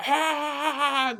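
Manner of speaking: sudden high angry yell, like a sergeant snapping; furious yell. Retold or imitated. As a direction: let out a sharp, high, angry shout. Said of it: "flips his lid".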